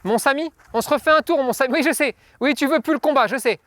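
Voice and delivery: playful talk to a dog; coaxing pet talk. Real, not acted. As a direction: coax the dog along gently. Trainer coaxes dog back to work.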